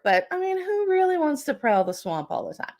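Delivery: usual speaking voice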